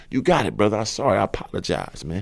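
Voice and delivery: soft, low, backing down; meek apology. Retold or imitated. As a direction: tuck your tail quietly. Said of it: "full tail tuckage".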